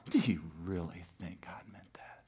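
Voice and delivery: soft, sly whispered temptation; serpent's whisper. Retold or imitated. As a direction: hiss the doubt like the serpent. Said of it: "It said".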